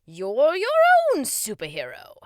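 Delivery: loud, theatrical king voice; encouraging king. Acted. King urges boy to be own hero, loud encouragement. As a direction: boost the kid with big projection.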